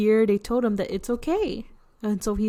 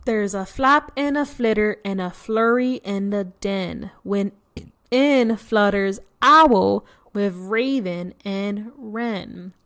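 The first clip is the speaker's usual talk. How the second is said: louder rhythmic read-aloud; picture-book verse; storybook rhyme; 4 dB louder than usual. A narrator's reading. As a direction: roll the rhyme out for the children. Reading rhyming verse to kids.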